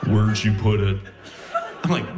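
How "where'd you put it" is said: low, menacing sleep-talker; Buffalo Bill. Acted. Sleep-talking girlfriend asks him once more, low sinister voice. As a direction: repeat it deep and creepy. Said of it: "Why do you sound like Buffalo Bill?".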